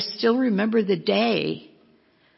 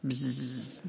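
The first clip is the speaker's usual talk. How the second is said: buzzing fly sound; 5 semitones lower, 7 dB quieter than usual. Shown as a demonstration. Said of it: "was flies".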